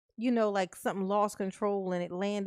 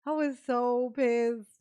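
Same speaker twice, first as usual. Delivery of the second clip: normal talking voice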